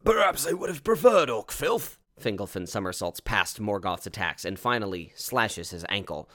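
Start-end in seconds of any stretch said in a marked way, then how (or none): 0.0-2.0 high, strained character voice; weak dying voice. Acted. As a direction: force it out as a wounded warrior. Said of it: "like, is weakly saying".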